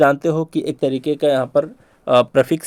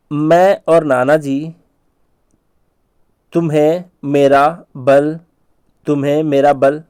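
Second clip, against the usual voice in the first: slow mnemonic; slower than usual. Shown as a demonstration. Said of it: "कुछ ऐसे relate करने की कोशिश करो".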